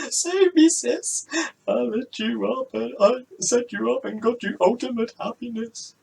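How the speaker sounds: high, loud gasping plea; begging sister. Acted. Anna begging her sister to save her, high gasping plea.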